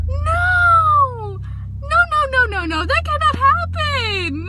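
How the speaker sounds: high, loud tearful no's; crying protest. Retold or imitated. Reader reliving crying at a plot twist, tearful protest. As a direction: plead through tears that it can't be true. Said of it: "I started to cry".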